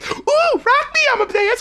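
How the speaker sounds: high, playful mock girl-singer voice; girl singer imitation. Fan mimicking a song's intro hook, imitated girl singer. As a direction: squeal the hook like a pop girl. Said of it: "where the girl saying".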